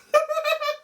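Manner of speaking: high mock evil cackle; villain cackle. The demon king laughs at the hero. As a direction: cackle like a smug demon lord.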